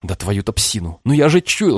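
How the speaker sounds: usual speaking voice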